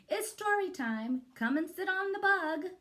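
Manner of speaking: high, bright teacher announcement; silly teacher voice. Acted. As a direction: call the class over like a cheery teacher. Teacher voice in read-aloud.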